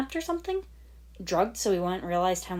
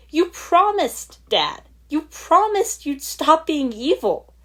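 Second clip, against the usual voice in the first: much higher and louder, slow and shouted; snapping furiously; 11 semitones higher, 10 dB louder, slower than usual. Acted. Lloyd yelling at his father, slow furious shout. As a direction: hammer each word of the promise. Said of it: "he snapped for a third time".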